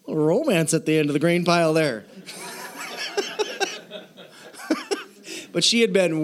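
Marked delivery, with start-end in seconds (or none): 2.1-4.0 airy laugh. Real, not acted. Laughs at the grain-pile romance.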